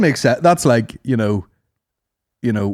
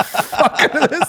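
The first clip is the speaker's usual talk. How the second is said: usual speaking voice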